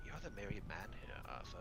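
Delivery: conspiratorial whisper. Acted. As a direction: whisper it close and sly. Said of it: "Reed will just whisper conspiratorially".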